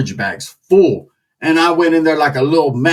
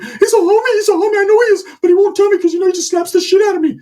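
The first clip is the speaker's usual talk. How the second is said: put-on much higher, boyish eager voice; Ricky's whiny kid voice; faster than usual. Comedian voicing cousin Ricky bragging about tio, high boyish voice. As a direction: gush like a naive youngster.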